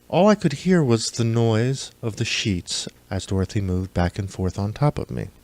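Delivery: ordinary delivery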